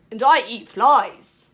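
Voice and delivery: slow, very loud frog shout; big boastful holler. Acted. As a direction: bellow it out, drawing the words long. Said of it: "shouted the wide-mouthed frog".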